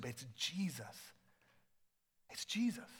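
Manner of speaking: soft awed whisper of recognition. Retold or imitated. Simeon whispering at last seeing the baby he waited for. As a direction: breathe the name in wonder.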